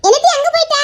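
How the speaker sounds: high, loud scolding; scolding shout. Acted. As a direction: call her out sharply. Brother scolding sister loudly.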